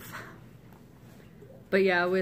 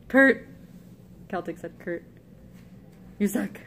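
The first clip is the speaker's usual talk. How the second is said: ordinary talk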